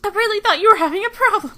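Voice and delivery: high, loud indignant protest; upset outburst. Acted. Mei scolding McCree over the broken A C, indignant shout. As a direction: shout it at a friend who misled you.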